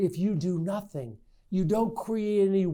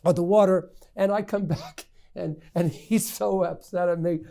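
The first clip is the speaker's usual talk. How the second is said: ordinary talk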